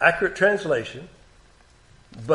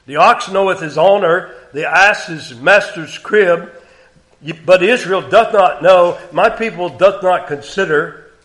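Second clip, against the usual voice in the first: raised, deliberate King James reading; solemn scripture reading; 2 semitones higher, 6 dB louder than usual. Acted. Preacher reading God's words to congregation, raised. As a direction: read it as God's complaint against Israel.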